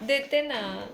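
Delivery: teasing mother, light and sing-song; playful threat. Acted. Mother teasing her little son with a playful threat. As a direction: tease him with a mock threat.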